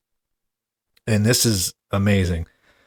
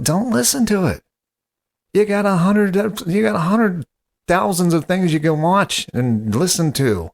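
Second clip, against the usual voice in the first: exasperated outburst; 10 semitones higher than usual. Acted. Host exasperated, shouting at caller.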